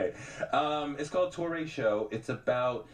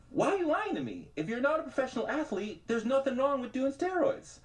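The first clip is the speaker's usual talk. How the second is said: exasperated inner voice; 13 semitones higher than usual. Retold or imitated. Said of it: "And I'm just like".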